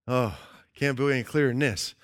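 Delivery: ordinary talk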